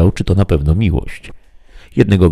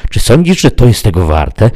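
raised, high far-off call; calling from afar; 5 semitones higher, 5 dB louder than usual. A narrator's reading. Unseen someone questions the climber in the storm, calling from afar. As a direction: project the question as if from a distance. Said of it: "powiedział ktoś jakby z oddali".

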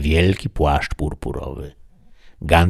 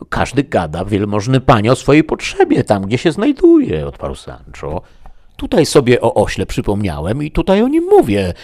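raised, hurried excuses of a simple squire; flustered Sancho; 6 semitones higher, 4 dB louder than usual. A narrator's reading. Sancho excusing himself to the duchess, raised and eager. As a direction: protest your innocence, a bit flustered.

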